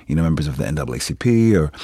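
ordinary delivery

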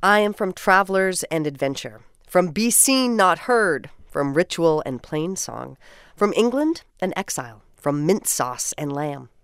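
measured, lyrical poem recital; poem reading. Acted. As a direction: read each line like a treasured memory. Reads community poem aloud.

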